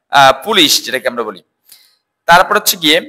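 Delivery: ordinary talk, nothing marked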